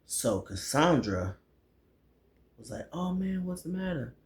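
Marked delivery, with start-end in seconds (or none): 2.9-4.1 high, soft voice of concern; softly cooed imitation; mock sympathy. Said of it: "warming up to him".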